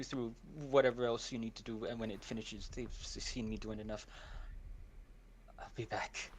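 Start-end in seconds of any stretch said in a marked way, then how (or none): 5.6-6.3 unvoiced, softly breathed goodbye; hushed farewell at the door; whispered promise. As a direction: whisper it as you slip out.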